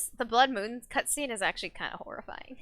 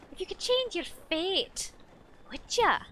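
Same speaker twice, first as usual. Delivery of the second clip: put-on a lighter, higher Scottish accent; 8 semitones higher than usual. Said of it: "the Scottish accent".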